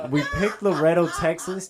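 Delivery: laughing through "Loretto, Texas"; amused laugh. Real, not acted. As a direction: chuckle out the town name. Laughing at own joke.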